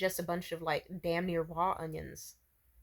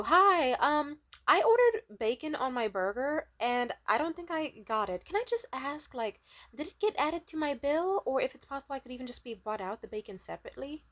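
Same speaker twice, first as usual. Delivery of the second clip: higher-pitched polite customer request; 6 semitones higher than usual. Retold or imitated. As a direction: ask the server sweetly, higher. Retold polite request to server.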